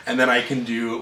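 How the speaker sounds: normal talking voice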